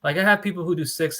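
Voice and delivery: ordinary delivery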